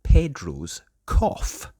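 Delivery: announced title. Acted.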